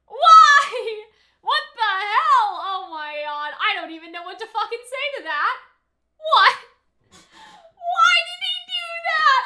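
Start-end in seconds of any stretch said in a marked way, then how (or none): 0.1-2.5 high, loud disbelieving shout; real outraged shout. Real, not acted. Outraged at show. 6.2-9.4 high, loud, slow crying wail; real crying outburst at the screen; distraught crying shout. Real, not acted. Distressed at character's act.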